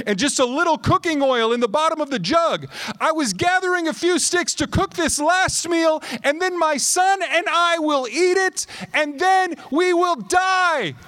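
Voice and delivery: high, loud despair building to a cry; desperate widow. Acted. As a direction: cry it out as if all hope is gone.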